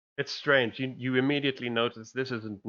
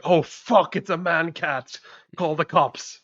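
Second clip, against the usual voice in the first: loud, high comic alarm; mock freak-out; 7 semitones higher, 8 dB louder, slower than usual. Game master showing how not to react to a cat man, mock panic. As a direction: yell it in exaggerated panic. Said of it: "You're not freaking out".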